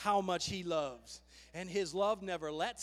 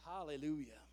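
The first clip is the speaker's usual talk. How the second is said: hushed hallelujah; 7 semitones lower, 10 dB quieter than usual. Real, not acted. Soft praise after verse.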